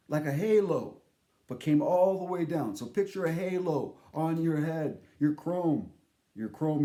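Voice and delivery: ordinary talk, nothing marked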